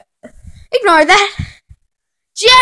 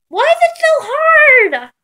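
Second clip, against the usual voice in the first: normal talking voice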